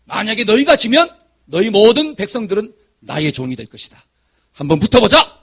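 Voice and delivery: Goliath's booming taunt, bursts of volume; bellowed dare, punching the openings; shouted challenge. Retold or imitated. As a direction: call out a battle challenge to a whole army. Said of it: "크, 외치는 거예요".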